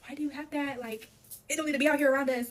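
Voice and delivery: high, loud, panicked demand; panicked yelling. Retold or imitated. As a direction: yell at him in alarm. Panicked at boyfriend bringing evidence.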